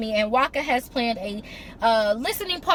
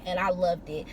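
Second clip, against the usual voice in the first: usual speaking voice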